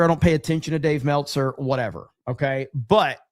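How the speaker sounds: ordinary talk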